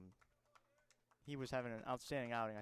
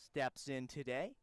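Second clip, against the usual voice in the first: mock announcer, sing-song inflection; Reeves Jackson; 3 semitones higher than usual. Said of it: "get my Reeves Jackson call".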